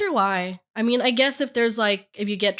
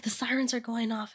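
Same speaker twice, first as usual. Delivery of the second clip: hushed warning; 9 dB quieter than usual. Retold or imitated. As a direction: say it softly over a sleeper. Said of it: "He's like".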